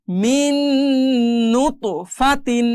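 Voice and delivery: long hummed n from "মিন" into "নুতফাতিন"; merged ghunna. Shown as a demonstration. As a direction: hold the double n, sound the "তিন" ending. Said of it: "এই নুন আর এই নুন মিলে যাবে".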